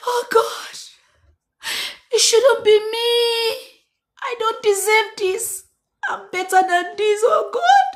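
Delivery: hammed-up crying, sung and shouted; mock crying lament. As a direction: wail it like a dramatic heartbroken lover. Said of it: "I'm already crying".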